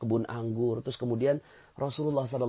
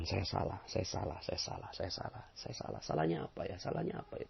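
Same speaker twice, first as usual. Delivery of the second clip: softer and softer, fading into a whisper; humble whispered confession; 6 semitones lower, 11 dB quieter than usual. Shown as a demonstration. Preacher shows the congregation the right way, fading whisper. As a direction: confess inwardly, fading to breath. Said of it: "Harusnya ucapkan".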